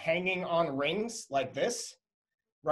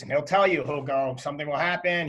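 ordinary talk, nothing marked

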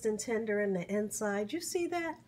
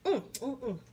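ordinary talk

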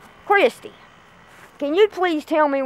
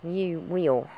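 low threat; 7 semitones lower, 5 dB quieter, slower than usual. Acted. Threatening the missing girl.

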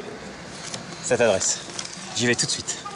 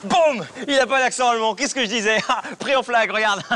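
triumphant gotcha; 7 semitones higher than usual. Real, not acted. Fan triumphs catching the fake.

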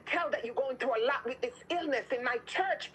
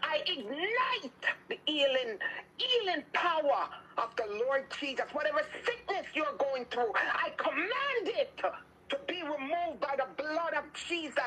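commanding healing prayer; 4 semitones higher than usual. Real, not acted. Preacher commanding sickness out of listeners, fervent prayer.

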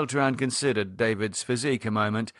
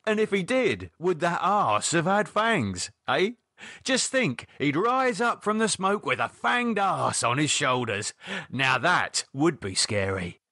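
higher-pitched, incredulous mockery; David teasing; 7 semitones higher than usual. David needling Lydia about a fanged arse, mocking. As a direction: wind Lydia up with the absurd picture.

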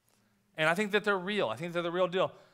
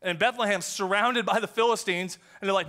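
normal talking voice

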